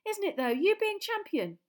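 put-on a starstruck kid bubbling over; excited Colin. Starstruck boy gushing to Harry outside, high and excited.

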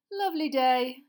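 high, loud hearty minister; jovial official, a bit too cheery; Fudge voice. Acted. Minister greeting the boy, overly hearty.

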